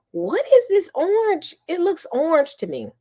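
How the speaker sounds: mock surprise. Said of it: "And I was like".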